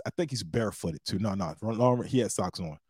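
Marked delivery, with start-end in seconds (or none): none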